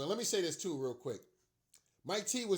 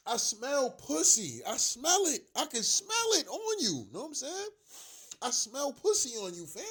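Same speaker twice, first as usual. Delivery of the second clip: mocking taunt; 8 semitones higher, 4 dB louder than usual. Real, not acted. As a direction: sniff out the weakness with a sneer. Mocking taunt, slow, high.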